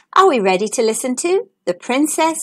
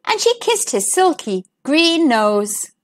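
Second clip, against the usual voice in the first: ordinary delivery, nothing marked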